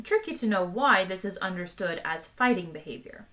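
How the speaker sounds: ordinary delivery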